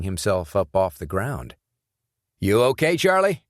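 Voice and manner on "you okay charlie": loud, much higher call to a friend. Acted. Sean calling out to his friend picking himself up, loud and high. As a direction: call across to him, checking he's alright.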